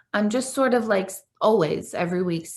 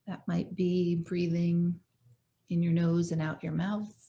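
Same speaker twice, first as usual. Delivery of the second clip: calm, unhurried meditation guide with long pauses; measured phrases, leaving room to breathe; guided meditation; 4 dB quieter than usual. Real, not acted. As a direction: gently lead listeners into their breath and body.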